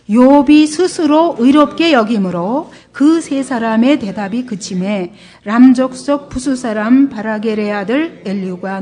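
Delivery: slow, loud, measured recitation; scripture reading. Real, not acted. Preacher reads Job chapter aloud with congregation, measured recitation.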